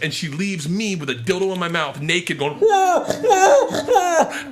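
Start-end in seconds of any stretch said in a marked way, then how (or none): 2.6-4.4 gagged mock wail. Gagged, naked on stage.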